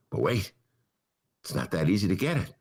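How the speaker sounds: ordinary talk, nothing marked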